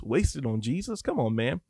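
normal talking voice

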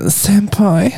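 whispered high senpai; excited anime fan. Acted.

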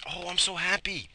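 high, delighted; gleeful. Real, not acted. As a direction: sing out the joy.

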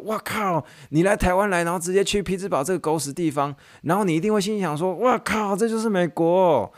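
high, mock-crude wife voice; swearing wife. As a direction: repeat her swearing line, hammed up. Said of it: "她那个中文意思就是这样". (太太夸张地骂脏话)

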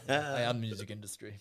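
hammy fake Swedish accent, laughing. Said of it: "a fake Swedish guy".

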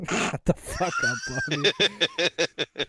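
high-pitched laughing outburst; laughing shout. Real, not acted. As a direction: yell it through laughter at your buddy. Teasing co-host, laughing outburst.

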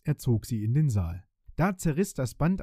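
ordinary delivery

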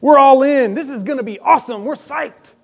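high-fiving the Lord on dry land; a hint of mock-eager cheering; pumped-up eagerness. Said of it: "we're like".

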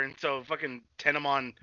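ordinary delivery

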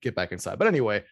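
ordinary talk

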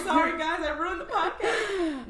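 high, loud laugh breaking into crying; laughing through tears. Real, not acted. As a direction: laugh it off while the tears come. Tears up while talking about mother.

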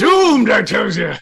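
put-on high, loud theatrical old man; over-the-top old-timer crying doom. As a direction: wail a warning like a crazy prophet. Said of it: "No, he said".

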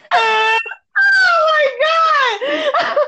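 very high, stretched-out laughing wail; helpless laughter. Real, not acted. Teller overcome by her own mix-up, helpless laughter. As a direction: gasp it out while cracking up.

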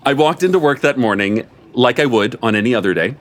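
plain reading voice

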